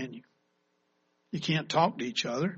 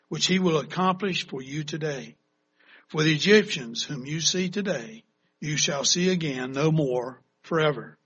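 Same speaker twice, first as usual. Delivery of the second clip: ordinary talk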